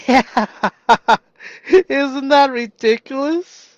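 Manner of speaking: slow, high, loud laughing words; laughing delight. Real, not acted. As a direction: laugh at how absurd it is. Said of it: "laughing delight".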